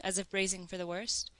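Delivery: ordinary talk, nothing marked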